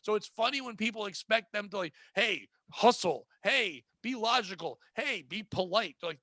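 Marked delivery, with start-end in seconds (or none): 2.1-6.0 slow, drawn-out orders; mock boss orders. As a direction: spell it out like an exasperated boss. Mock boss demands to workers.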